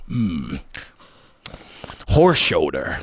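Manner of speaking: low maniacal villain voice; Heath Ledger's Joker. Acted. One Joker offers the other a dish, low and maniacal. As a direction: drop low into a menacing Joker. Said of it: "talking to Heath Ledger's Joker".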